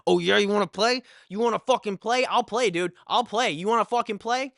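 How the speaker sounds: loud, high, goading challenge; aggressive taunt. Retold or imitated. Storyteller replaying the headlock fight with his friend, aggressive taunting.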